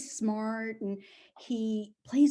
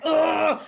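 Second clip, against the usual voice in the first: loud, raised "ugh"; exasperated groan; 7 semitones higher, 8 dB louder than usual. Retold or imitated. Owner woken by puppy at four a.m., groaning. Her reaction to puppy at night.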